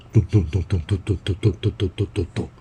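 fast, light clomping of feet up stairs; quick footstep sounds. A narrator's reading. Mom rushing up the stairs, fast footsteps. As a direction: rattle off the 咚咚咚 quickly like hurrying steps. Mother hurrying upstairs footsteps.

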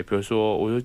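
normal talking voice

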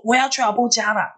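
high-pitched, stubborn outburst; retold fierce insistence. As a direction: act out a cross sleepwalker insisting. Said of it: "他说我很凶".

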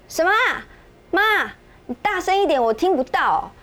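high, loud call from the shower; daughter shouting. Retold or imitated. Daughter in the shower calls loudly to her mother. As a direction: yell it out through the bathroom door. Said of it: "在那边喊说".

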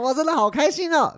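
put-on very high squealing drunk delight; drunk giddy joy. Running circles on the road, squealing drunk delight. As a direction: squeal it out like a tipsy kid.

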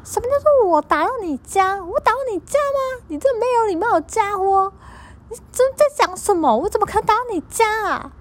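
very high, shrill-pitched mock fury, rushing the last line; storyteller mimics a customer blowing up at her, in mock fury; exploding customer. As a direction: shriek the customer's outraged rant way up high. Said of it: "他就爆炸， 大爆炸， 大大爆炸".

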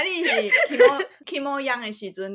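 ordinary delivery

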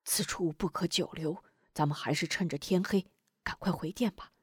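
softly urging to leave; hushed warning. Acted. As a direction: warn them quietly so no one hears. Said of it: "提醒道".

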